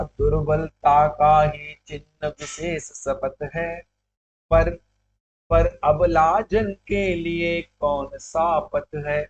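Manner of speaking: slow, measured reading of verse; verse recitation. Acted. Teacher recites the poem's couplet to students, slow verse recitation. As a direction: recite with long drawn-out syllables.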